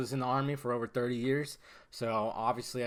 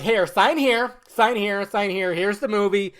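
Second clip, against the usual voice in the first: raised, louder repeated commands; mock handing-out patter; 4 semitones higher, 6 dB louder, slower than usual. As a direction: act out brisk orders to a crowd. Imitates handing out forms.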